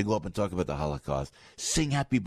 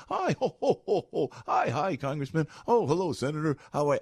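high, sing-song fake friendliness; mock glad-handing; 7 semitones higher than usual. Host mocking congressmen greeting each other, high fake cheer. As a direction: lampoon the politicians' cheery greetings. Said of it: "everybody's all friendly".

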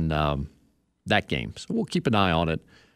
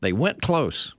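measured recital with stretched phrase endings; dramatic copy reading; 5 semitones higher than usual. Acted. Host testing odd soccer copy on his co-host, theatrically. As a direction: read it out grandly, landing hard on "went close". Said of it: "how about this sentence".

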